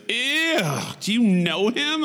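high-pitched mock disgust; Sue. Coworker questioning a kiss with a stranger, disgusted. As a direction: recoil in a very high, scandalized voice. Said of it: "my coworker, Sue, was like".

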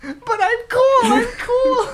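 slow, very high, loud wail; hammed-up crybaby protest through laughter; mock whining. Plays rejected fan whining.

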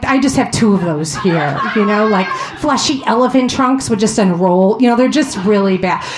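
usual speaking voice

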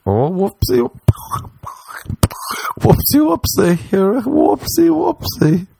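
whoopsie climbing very high, stretched; squeaky baby talk. Pretend. Baby-talking through the mess, squeaky high whoopsie.

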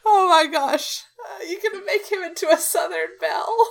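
high, helpless laughter; cracking up at the voice; laughing. Real, not acted. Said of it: "laughing hard".

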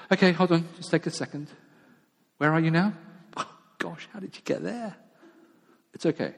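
put-on calm a frightened child who's lost; gentle reassuring God.

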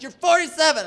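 high, loud insistent shout; imitated heckling yell. Kid answers the comedian, high mock yell. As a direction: holler it back like the heckling kid. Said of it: "he's just yelling".

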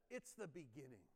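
ordinary delivery

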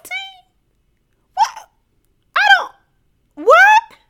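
can't even finish the sentence, pitch shooting up; incredulous. Real, not acted. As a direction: sputter in total disbelief. Incredulous about Louise switching sides.

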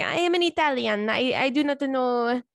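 hammed-up Italian accent, slow and deliberate; dodging the papers with a fake Italian accent; mock Italian accent. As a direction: play dumb as a fake Italian tourist. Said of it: "'Cause I'm gonna say".

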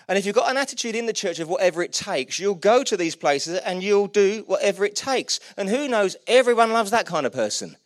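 high, loud, driving preacher delivery; rousing sermon climax, loud and urgent; impassioned preaching. Real, not acted. Preaching at full force.

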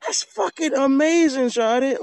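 high-pitched, drawn-out amazement; amazed. Retold or imitated. Telling of the nest-building crow, high amazed outburst. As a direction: blown away, voice way up high. Retells own amazed reaction to bird.

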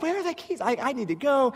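high, frantic self-quote; frantic panic. Retold or imitated. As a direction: panicky search for keys.

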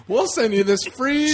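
infomercial pitchman. Pretend. Continues the infomercial bit.

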